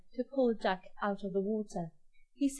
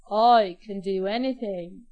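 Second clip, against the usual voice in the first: loud, self-assured ogre; acted ogre's swagger; proud boast; 9 dB louder than usual. A narrator's reading. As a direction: boast loudly, without raising the pitch. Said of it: "replied the proud ogre".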